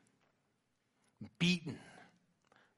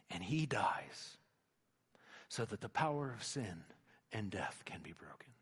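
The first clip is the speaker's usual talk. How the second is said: hushed whisper; 5 semitones lower, 10 dB quieter than usual. Real, not acted. Solemn climax about crucifixion.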